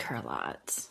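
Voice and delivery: unvoiced whispered confession. Real, not acted. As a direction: whisper it close to the mic. Whispered aside.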